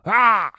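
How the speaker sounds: short sharp yelp of pain, high and loud; mock yell. Old man yells after the ball cuts him, sharp yelp. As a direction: let out a sudden retold cry. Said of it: "He lets out a yell".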